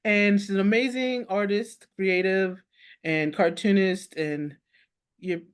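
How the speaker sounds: ordinary talk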